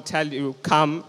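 ordinary delivery

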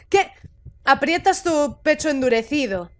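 usual speaking voice

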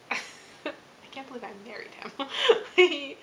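laughing through the words, slowly. Real, not acted. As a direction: laugh in disbelief at him. Laughing about her husband's antics.

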